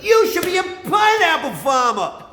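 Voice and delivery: high, loud, slow mocking voice of everybody; mock nagging crowd. Mimicking everyone telling him to farm pineapples, high and shouty. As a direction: shout the advice in a silly high voice. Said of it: "everybody's like".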